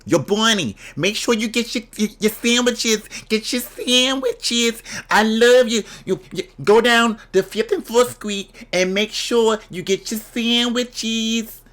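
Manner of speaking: high-pitched New York mom calling out reminders. Acted. Mom reminding Bonnie to get the sandwiches, high New York mom impression.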